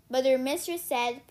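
plain reading voice

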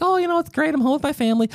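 high mock-cheerful voice; mocking sing-song. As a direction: parody a chirpy, carefree person. Mocking people who enjoyed lockdown.

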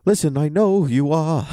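high, overdramatic hero voice; hammed-up Mon-El. Podcaster acting out Mon-El's line for the cohost, hammed-up and high. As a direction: ham it up like a TV hero's big speech.

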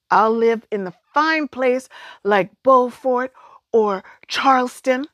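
excited. A narrator's reading. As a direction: dream out loud about the city. Girl dreams of the mainland.

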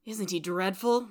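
ordinary delivery

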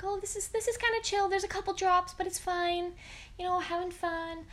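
high, breezy voice acting totally relaxed; mock carefree self. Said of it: "I was like".

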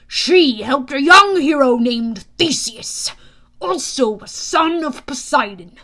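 put-on loud, imperious old tale-teller; grand, deliberate and loud; irritable god, storytelling. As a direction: begin a pointed story.

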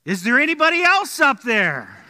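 high, loud punchline call; comic desperate shout; hopeful yell. Retold or imitated. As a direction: shout it up again, hoping for someone better. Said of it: "And the guy says".

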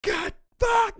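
high furious yelled curses; angry yelling. Retold or imitated. Retelling the father cursing alone in his room, yelling. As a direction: scream the curses in rage. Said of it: "He's yelling".

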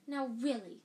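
a seer's pained rebuke; prim reproach. A narrator's reading. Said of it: "said Professor Trelawney".

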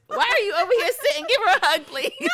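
high, loud laughing urge; emotional host laughing and pleading; laughing plea. Real, not acted. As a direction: beg through tears and laughter. Host overcome, laughing and urging.

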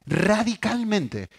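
Radicalmente drawn out very slowly, pitched high; Radicalmente, slow and high for emphasis; stress on radicalmente. Shown as a demonstration. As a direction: stretch out every syllable of Radicalmente. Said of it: "cambia radicalmente".

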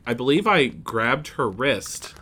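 ordinary talk

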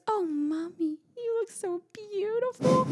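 high, slow little-girl voice imitating daughter; high cooing child voice; toddler voice. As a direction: mimic a sweet toddler complimenting mom. Mother quotes toddler daughter.

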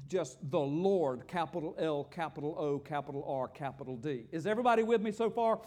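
normal talking voice

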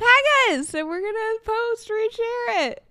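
high, loud call to the audience; excited call-out. Real, not acted. Livestream host urging viewers to tag them, excited call-out.

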